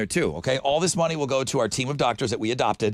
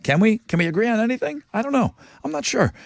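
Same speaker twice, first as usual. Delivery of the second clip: playful doubt; 9 semitones higher than usual. Acted. Joking doubt in ad read.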